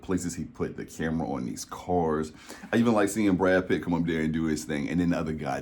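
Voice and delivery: ordinary talk, nothing marked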